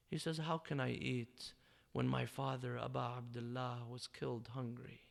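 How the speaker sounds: subdued grief. Retold or imitated. Retelling the grieving son's answer.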